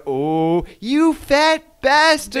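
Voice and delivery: put-on high, slow, stretched American accent on "you fat bastard". TikTok Americans shouting at English football, in American accent. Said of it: "in an American accent".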